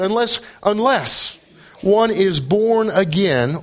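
slow, loud, pressing each word; emphatic warning. Acted. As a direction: lean hard on born again. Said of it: "Jesus' emphatic warning".

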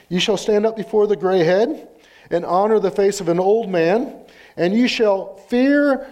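high preaching voice, slowing at the end; emphatic scripture reading. Acted. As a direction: proclaim the verse, stretching the last words.

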